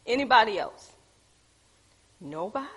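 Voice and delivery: ordinary talk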